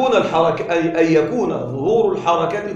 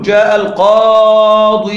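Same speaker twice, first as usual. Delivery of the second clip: loud, drawn-out القاضي with a long held -ī ending and no extra vowel; long final ي; 6 dB louder, much slower than usual. Shown as a demonstration. Teacher showing students how القاضي ends, final ī held long. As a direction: stretch the long ī at the end of القاضي. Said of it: "سأقول".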